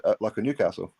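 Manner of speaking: normal talking voice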